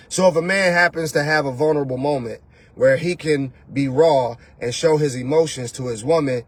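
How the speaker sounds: ordinary delivery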